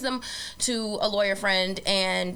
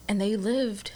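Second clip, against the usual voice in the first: whispered; 4 dB quieter than usual. A narrator's reading. Ironic fairy-tale ending.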